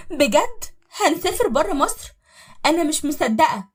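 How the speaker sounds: high, loud delighted wife; excited disbelief. Acted. Wife thrilled about travelling abroad. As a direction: squeal with joy. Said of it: "وهي مبسوطة".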